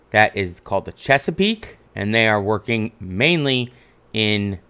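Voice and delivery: ordinary talk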